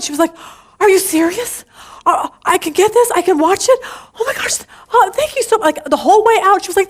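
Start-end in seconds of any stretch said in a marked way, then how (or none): 0.8-5.8 higher, gushing retold delight with a gasped oh my gosh; imitating excited waitress. Waitress thanking the speaker for the pen, imitated excitement. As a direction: play the waitress thrilled at a free gift. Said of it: "She was so excited".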